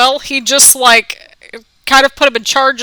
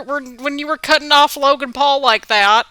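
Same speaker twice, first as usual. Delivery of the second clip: ordinary delivery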